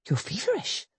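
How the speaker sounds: quiet, concerned murmur; soft worried. A narrator's reading. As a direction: say it softly, worried. Softly concerned.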